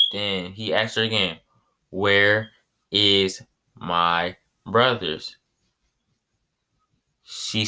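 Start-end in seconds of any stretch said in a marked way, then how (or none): 1.9-5.4 drawn-out demanding question; slow. Retold or imitated. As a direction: stretch every word out. Son repeats question slowly.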